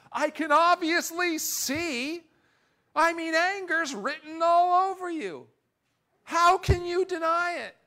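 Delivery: mock someone sure they see anger; accusing shout. Imitating someone accusing the person across from them, shouting. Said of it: "looking at the person across from us and saying".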